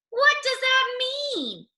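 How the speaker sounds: very high, slow, baffled question; puzzled rabbit. Acted. Rabbit asks what the saying means, very high and slow. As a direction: squeak it out confused.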